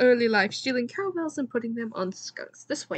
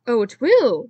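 excited; 9 dB louder than usual. Acted. Bernard clapping, excited.

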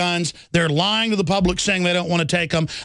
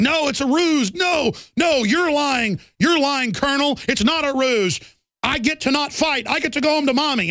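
higher, half-shouted panicky soldier voices; mock panicky soldiers; 6 semitones higher than usual. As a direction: play the scared troops begging to quit, higher. Panicking soldiers quoted in the trenches.

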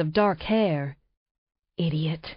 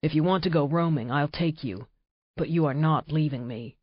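low, commanding growl-like order, lower voice; predator deep; 5 semitones lower, 4 dB quieter than usual. Acted. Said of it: "his voice predator deep".

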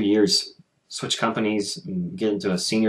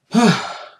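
heavy frustrated sigh; 12 semitones higher, 4 dB louder than usual. Real, not acted. Venting frustration about job.

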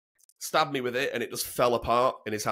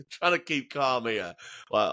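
ordinary delivery

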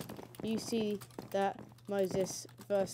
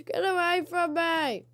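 high, slow, loud cry to back off; panicked yell; 9 semitones higher, 8 dB louder than usual. Acted. Gamer fleeing a mob, panicked yell. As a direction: shoo it away in a panic.

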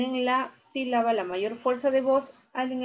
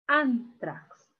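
"Antrax" split ÁN... trax, weight on ÁN; stress on first syllable. Shown as a demonstration. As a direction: hold ÁN in "Antrax", then drop on trax. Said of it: "Aquí está la mayor fuerza de voz".

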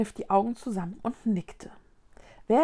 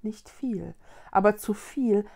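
ordinary delivery

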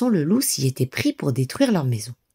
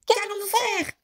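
much higher, frightened piglets; cried out together; 5 dB quieter than usual. A narrator's reading. The two pigs asking their brother for help, high and scared. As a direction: wail it in alarm. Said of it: "s'écrièrent ... en chœur".

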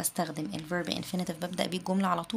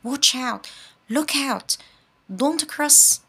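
"Watch", "Look", "Don't" shouted higher and louder; loud warning, stress on start; 6 semitones higher, 7 dB louder, much slower than usual. Shown as a demonstration. Teacher warning someone off the road, stress on the first word. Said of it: "لازم اعمل ستريس على بداية كلامي".

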